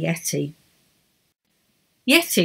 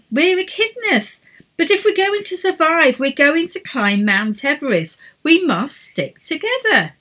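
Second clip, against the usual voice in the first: exasperated leader; 8 semitones higher than usual. A narrator's reading. Edith scolds team.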